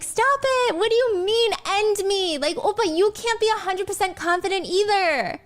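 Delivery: high, cute mock-angry whine; joking angry aegyo. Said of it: "She's still using her aegyo voice".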